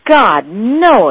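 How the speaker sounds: a loud, alarmed cry; exclaiming in alarm. A narrator's reading. Parish priest cries out in horror at the associate's news. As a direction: blurt it out in horror. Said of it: "exclaimed the parish priest".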